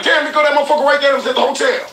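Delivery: high, fast, astonished retelling; reliving her shock on spotting the man; retold amazed exclaim. Woman recognizing the hotel man on YouTube, high and fast. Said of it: "She like, she looked on YouTube like".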